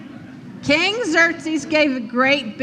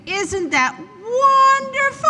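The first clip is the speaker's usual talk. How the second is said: excited; 10 semitones higher than usual. Acted. Host delights at the rescue.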